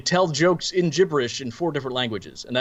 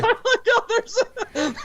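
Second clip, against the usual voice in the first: high-pitched, breaking up with laughter; cracking up, voice shooting up high; laughing hard. Real, not acted. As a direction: talk through helpless laughter. Cracking up at the imagined scene.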